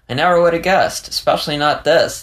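impressed disbelief; amazed. Acted. Dari amazed by the revelation.

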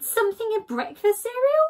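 high, bright interrupting Skunk; eager Skunk. Acted. Skunk interrupting Badger's lecture, high and eager.

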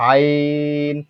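আইন with the ai drawn out; long held আইন; elongated glide. Shown as a demonstration. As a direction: stretch আইন evenly on a level note. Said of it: "এক আলিফ পরিমাণ টানবো".

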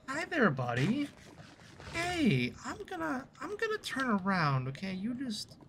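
high, sing-song talking to a critter; nervously cooing at a buddy, pitch gliding down; mock-coaxing. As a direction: sweet-talk a dangerous creature in a high voice. Coaxing a mob in game.

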